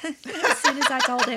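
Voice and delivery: laughing. Real, not acted. Laughing at boyfriend's banana answer.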